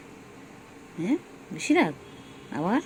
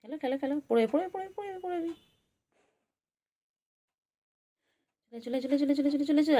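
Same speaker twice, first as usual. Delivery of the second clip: much higher sing-song play calls; 9 semitones higher, faster than usual. Real, not acted.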